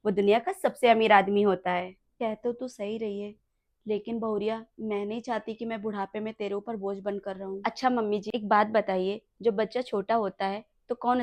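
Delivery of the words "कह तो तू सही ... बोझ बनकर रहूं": put-on soft, sorrowful old mother; sad lament. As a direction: mourn the family falling apart. Old mother fears being a burden. (धीमी उदास शिकायत)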